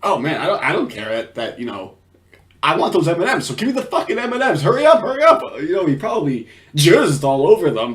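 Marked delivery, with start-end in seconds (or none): 2.6-5.4 pitched-up, hurried mock demand; rising to a loud, high hurry-up; impatient demand. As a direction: act out someone urgently badgering for candy. Acting out the man's impatient demand.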